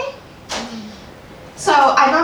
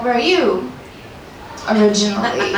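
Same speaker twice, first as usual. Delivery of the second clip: pointed turnaround question; 4 semitones lower than usual. Retold or imitated. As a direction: turn her own question on her. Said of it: "so I was like".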